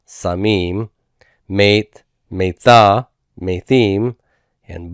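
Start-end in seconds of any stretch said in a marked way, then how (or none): none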